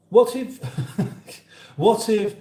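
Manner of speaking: giggling high and loud; laughing. Real, not acted. Laughing at his own joke to chat, high and loud. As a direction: laugh through the words.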